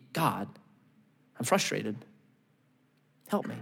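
understated frustrated prayer; quiet plea. Retold or imitated. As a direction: murmur a tired plea to God. Voicing Habakkuk's prayer.

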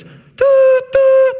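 high, loud hooting kettle whistle. Retold or imitated. Preacher imitates the kettle whistling just like the train horn. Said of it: "the kettle gets hot and it goes".

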